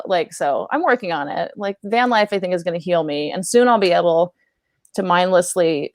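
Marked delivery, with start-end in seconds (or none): none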